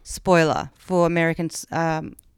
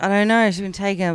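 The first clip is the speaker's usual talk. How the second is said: ordinary delivery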